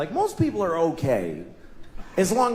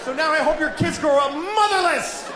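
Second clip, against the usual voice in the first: mock road rage; 9 semitones higher than usual.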